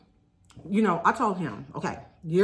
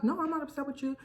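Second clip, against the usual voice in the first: mock reassurance; 9 semitones higher, 4 dB quieter, faster than usual. Friend insists she's not upset.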